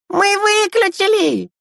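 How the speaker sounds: put-on very high, stretched-out child cry; slow, high-pitched kid's call; little kids yelling. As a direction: squeal it after someone running off. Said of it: "завопили ему вслед младшие".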